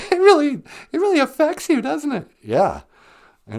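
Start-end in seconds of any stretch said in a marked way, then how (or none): 0.1-2.3 high, loud laughing question through giggles. Real, not acted. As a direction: laugh it out while teasing him gently. Listener laughing at his emotion.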